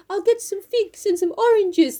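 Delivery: high, slow character voice; Mister Bear. Acted. Bear shopping for the feast, put-on voice. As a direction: draw it out in a put-on suitor's voice. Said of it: "No, he does buy".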